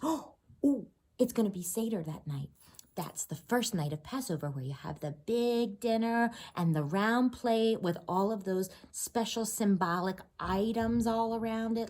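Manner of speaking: ordinary delivery, nothing marked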